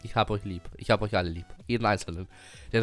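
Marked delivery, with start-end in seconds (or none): none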